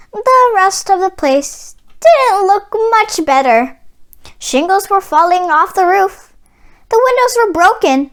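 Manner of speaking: plain reading voice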